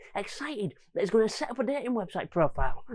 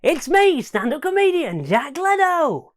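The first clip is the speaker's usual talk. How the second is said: loud, slow showbiz intro; hammed-up compère, loud and high; self impression; 6 semitones higher, 7 dB louder, slower than usual. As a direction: announce yourself like a star. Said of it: "my impression of me before the lockdown".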